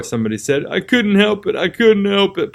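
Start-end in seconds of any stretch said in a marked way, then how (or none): 0.7-1.5 moaning. Acted. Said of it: "Potter moaned". 1.5-2.5 moaning. Acted. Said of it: "Potter moaned".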